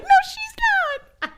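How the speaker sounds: high drawn-out retort; slow, high-pitched mock denial; mock protest. Acted indignant denial in retold scene.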